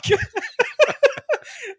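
big high burst of laughter; real laughter at a movie line. Real, not acted. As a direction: crack up hard. Laughing at his own quote.

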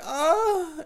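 a hammed-up falsetto moan thrown out; mock moan. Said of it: "I'll throw, like, five more out there".

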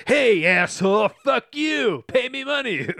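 brash mocking yell at a debtor; mock rude debt collector. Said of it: "They're not just like".

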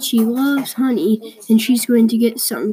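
ordinary delivery

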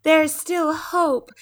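high, slow mocking singsong; high slow mocking parody. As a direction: parody his hopeful words. Mocking his line about hope.

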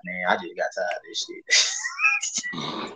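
high, slow, put-on fed-up voice; mock exhausted coworker quitting; imitated weary coworker. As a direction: drag it out like you're done with it all.

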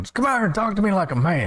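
high-pitched mock holler; hammed-up, high doorstep challenge. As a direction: imitate a salesman hollering at the house. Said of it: "Hollering at 'em".

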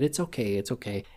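hushed, gentle comfort; soothing reassurance. Retold or imitated. Nurse comforts the crumpled man, soft and soothing. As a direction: softly soothe someone upset.

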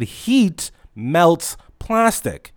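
heat melts plastic, spelled out very slowly; slow and firm. Retold or imitated. Employee to customer, words stretched slow and firm. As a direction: drag each word out like to a child. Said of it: "I said".

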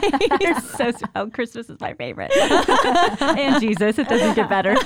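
giggling through the words; laughing. Real, not acted. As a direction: laugh while you talk. Laughing through her answer.

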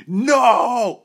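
loud, high yelled "no"; yelling. Retold or imitated. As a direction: cry it out in a drawn-out yell. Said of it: "I'm yelling".

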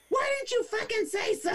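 hammed-up indignant yelp; furious Karen. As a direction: squeal it at him.

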